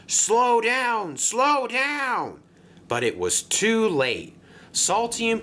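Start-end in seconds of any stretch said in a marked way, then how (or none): 0.1-2.4 high, urgent warning cry; alarmed calling out. Acted. Dock worker warning a barge coming in too fast, alarmed. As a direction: call out in high-pitched alarm.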